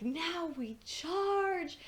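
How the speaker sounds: slow, high mock battle cry; drawn-out heroic charge call; Scotsman quote. Mimicking the Scotsman ordering the charge, mock heroic. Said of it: "the Scotsman is like".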